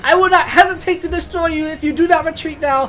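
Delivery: loud, grand priestess threat with a laugh; shouted threat. Acted. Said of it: "I shout to the rats".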